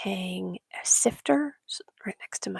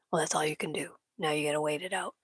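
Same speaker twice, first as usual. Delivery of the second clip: lower, whispered businesslike verdict; serious vet; 4 semitones lower, faster than usual. Retold or imitated. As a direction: deliver the grim news flatly, all business. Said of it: "all business and very serious".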